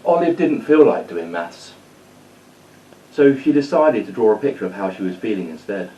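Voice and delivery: put-on lively read-aloud storytelling for kids; story narration. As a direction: tell it like a bedtime story to children.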